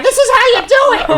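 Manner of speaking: high mock grandma ordering around; bossy Nana voice. Host playing Nana yelling at Sam, high mock bossy. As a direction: play the bossy granny boss. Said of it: "Start yelling at Sam".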